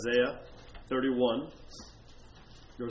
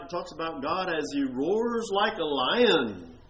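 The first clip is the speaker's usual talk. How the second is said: normal talking voice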